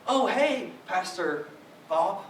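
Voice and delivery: cheery greeting. Retold or imitated.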